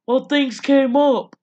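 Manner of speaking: loud, high, drawn-out excuse; mock indignant shout, stretched out slowly; retold defensive retort. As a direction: imitate dad protesting at full volume. Father's excuse in acted phone quarrel.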